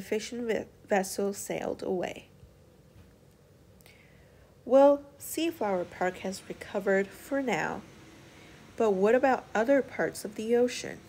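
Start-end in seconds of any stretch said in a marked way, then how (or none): none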